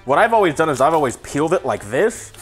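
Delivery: ordinary delivery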